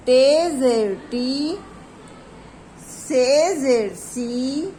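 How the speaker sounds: slow, sing-song syllable drill, each sound drawn out; slow drilled reading. Shown as a demonstration. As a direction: read each letter slowly for children to repeat. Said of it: "मैं चलिए दोबारा से start करती हूँ". (धीरे धीरे खींचकर पढ़ाना)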